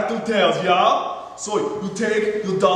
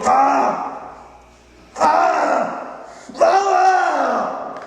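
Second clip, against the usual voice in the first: rising battle yell; 7 semitones higher, much slower than usual. Pretend. Woodworker chopping the half pin, building mock war cry. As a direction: yell as you strike, building each time.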